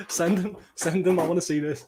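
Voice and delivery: high-pitched, amused urging; eager, high and giggly; tickled eagerness. Real, not acted. Amused, eager demand after laughing.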